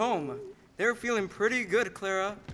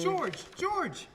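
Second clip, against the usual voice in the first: calling out from afar; 8 semitones higher, 4 dB quieter than usual. Acted. Uncle Billy calls from car.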